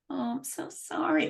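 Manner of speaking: soft, pitying oh with a head tilt; pitying sympathy. Retold or imitated. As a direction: coo it softly like a pitying friend. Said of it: "someone might look at me and go".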